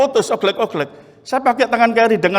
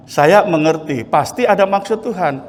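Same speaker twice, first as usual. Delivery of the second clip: ordinary talk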